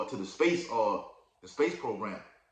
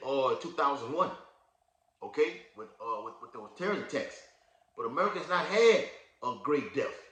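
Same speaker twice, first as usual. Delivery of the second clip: usual speaking voice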